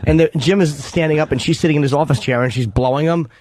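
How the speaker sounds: ordinary delivery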